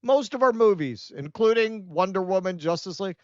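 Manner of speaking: ordinary talk, nothing marked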